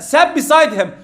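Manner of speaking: ordinary talk, nothing marked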